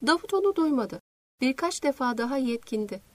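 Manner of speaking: ordinary delivery, nothing marked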